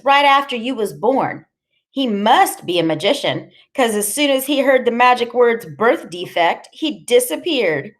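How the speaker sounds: high, needling character voice; mocking woman telling a nasty joke; cruelly taunting Loretta. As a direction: rub it in, slowing on the punchline.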